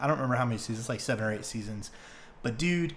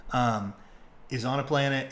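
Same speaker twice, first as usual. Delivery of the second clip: usual speaking voice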